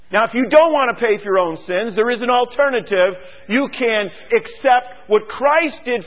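loud, high fervent preaching; impassioned revival preacher shouting; preaching at full force. Acted. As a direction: drive it home from the pulpit, rising and loud.

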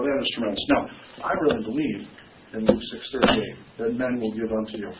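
normal talking voice

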